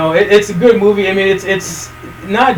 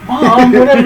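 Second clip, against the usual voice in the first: protesting daughter; 5 semitones higher, 5 dB louder than usual. Retold or imitated. Voices daughter protesting to mom.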